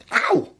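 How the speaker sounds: sudden high ow; yelp of pain. Acted. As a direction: yelp as a finger gets pricked. Hurts finger on silver spike.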